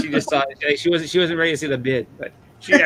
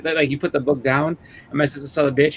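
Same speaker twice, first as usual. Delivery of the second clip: ordinary talk, nothing marked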